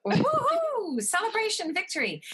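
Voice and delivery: high, excited woohoo cheer; celebratory whoop. Real, not acted. Host cheering her guest's new role, excited woohoo. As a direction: whoop it up for the new marketing director.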